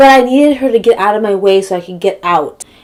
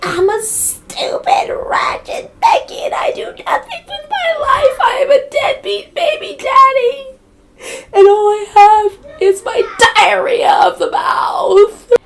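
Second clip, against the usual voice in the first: very high, slow, screechy caricature; mocking neighbor's rant; 3 dB louder than usual. Mocking what she heard from the yelling neighbor, high screechy wail. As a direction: wail it slowly like a ridiculous tantrum. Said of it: "This is literally all I heard".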